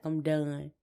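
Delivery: normal talking voice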